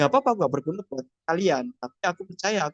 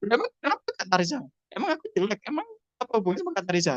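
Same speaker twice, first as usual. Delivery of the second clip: mock defiant reply; 4 semitones higher, 3 dB quieter, much faster than usual. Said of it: "Bisa Kak Tarisa ngolong-ngolong".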